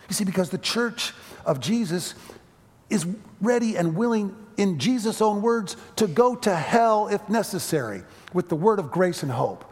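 rally the church with rising force; preaching. Acted.

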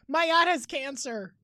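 loud hammed-up wail; mock dramatic plea. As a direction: overdo the sob-story plea. Mocking rich person plea.

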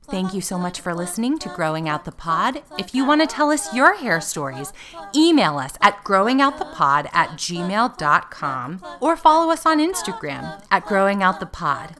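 loud, upbeat host sign-off; podcast outro. Acted. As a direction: sell the outro like an announcer.

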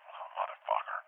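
soft, voiceless curse under the breath; muttered dismay. Retold or imitated. Dreamer looking down at his yoga pants, muttered dismay. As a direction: curse quietly to yourself.

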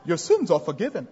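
put-on solemn, hushed, lightly done English accent. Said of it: "he sounds like he's from England".

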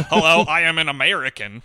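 stiff fake American, laughing through it; mocking an actor's bad American accent. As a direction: lampoon a Brit faking American. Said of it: "I'm from America".

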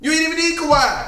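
high mocking taunt. Real, not acted. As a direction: rub it in, loudly. Taunting repeat.

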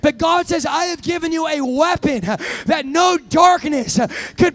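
loud, high, shouted proclamation; preaching at full force. Acted. Preacher shouting God's promise to the church, at full force.

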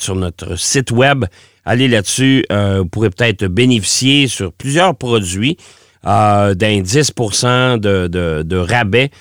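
ordinary delivery

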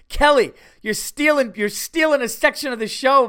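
high, loud playful call-out; teasing shout. Real, not acted. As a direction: yell it at a friend, ribbing.

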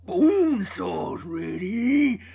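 very slow, drawn-out wrestler boast; Macho Man. Retold or imitated. Fan recalling the Spider-Man wrestling scene, Macho Man impression. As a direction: stretch each vowel like a ring announcement. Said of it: "I just remember him, uh, in Spider-Man".

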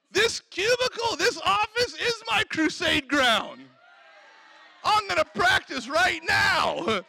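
high-pitched, emphatic proclamation; fired-up declaration. Acted. Worker claiming the office for God, fired up. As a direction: declare it with acted zeal.

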